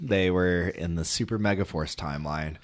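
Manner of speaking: ordinary delivery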